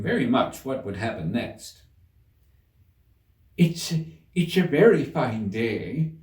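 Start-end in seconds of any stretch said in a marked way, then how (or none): 3.6-6.1 slow, hesitant rabbit; timid voice. A narrator's reading. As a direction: stammer it shyly. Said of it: "said a timid voice".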